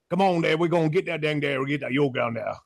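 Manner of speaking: a hint of Louisiana swamp talk; Louisiana accent. Coach mimicking Louisiana locals in a Cajun accent. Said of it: "What about, what about Louisiana?".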